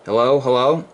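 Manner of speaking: steady test count over the air; radio mic check. Real, not acted. Ham operator testing his amp, radio check calls.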